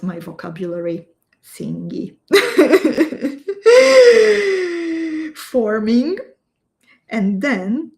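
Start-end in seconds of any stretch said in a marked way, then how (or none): 2.3-5.3 loud high burst of laughter; big embarrassed laugh. Real, not acted. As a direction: laugh out loud at your own clumsy word. Laughs at own word thingy.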